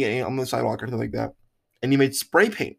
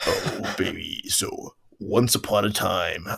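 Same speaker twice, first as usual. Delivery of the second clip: low, slow deep storyteller voice; 7 semitones lower, 5 dB quieter, slower than usual. Pretend. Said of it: "speak with my deep voice".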